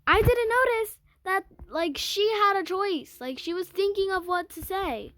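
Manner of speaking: high, loud, outraged burst; worked-up realization. Real, not acted.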